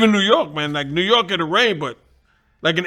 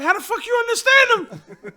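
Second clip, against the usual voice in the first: high squeal of laughter, can't believe it; cracking up, much higher; laughing disbelief. Retold or imitated. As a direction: laugh the question out in amazement. Baffled by fast Scouse talk.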